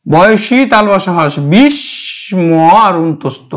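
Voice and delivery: slow spelled-out letters of বিস্ময়; spelling out the letters. Shown as a demonstration. Teacher spelling the word for students. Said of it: "সংস্কৃত আমরা উচ্চারণ করব".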